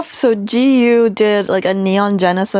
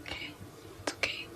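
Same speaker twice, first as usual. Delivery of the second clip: barely voiced gentle hush; soothing whisper; 26 dB quieter than usual. Streamer hushing Nanners the plush. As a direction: whisper it tenderly.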